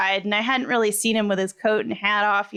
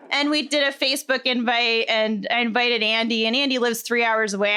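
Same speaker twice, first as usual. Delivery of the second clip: usual speaking voice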